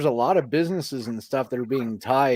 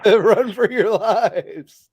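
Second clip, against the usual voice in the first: laughing echo; 9 semitones higher, 5 dB louder, much slower than usual. Pretend. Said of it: "laughs, echoing the call".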